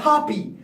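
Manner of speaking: Hapi said with an open "ah", HAH-pee; pronouncing Hapi. Shown as a demonstration. Magician naming the Nile god, Hapi said HAH-pee. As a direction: say Hapi as "hoppy". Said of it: "not Happy, Hapi".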